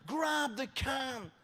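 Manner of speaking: stretched-out mock scream across the water; retold scream. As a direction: call it out long and slow, like lifeguards yelling. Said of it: "And they were screaming".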